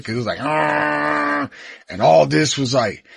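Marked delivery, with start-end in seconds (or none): 0.4-1.5 long, held "aah" like a horn; imitated horn blare. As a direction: drone it out like a horn going off. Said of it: "like, a horn to it".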